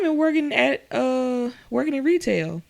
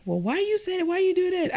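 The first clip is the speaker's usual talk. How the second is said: much higher, mock puzzled question; 13 semitones higher than usual. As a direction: play the colleague who doesn't get it. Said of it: "she was like".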